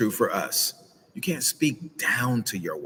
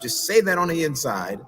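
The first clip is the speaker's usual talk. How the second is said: ordinary talk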